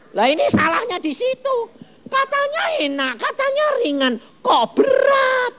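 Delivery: high, wailing "katanya enak, katanya ringan, kok berat?"; mock a believer whining that the yoke is heavy; whiny mock complaint. Preacher mimics a complaining believer, high and whiny.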